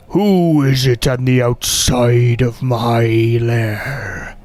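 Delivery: slow, drawn-out dragon challenge; huge creature demanding who is there; booming dragon. Acted. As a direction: boom it slowly from inside the lair. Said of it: "And you hear".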